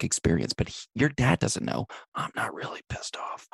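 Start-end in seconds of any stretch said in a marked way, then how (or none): 2.1-3.5 whispered secret. Acted. Hired guy confiding to the kid, whispered. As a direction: hush it so dad can't hear.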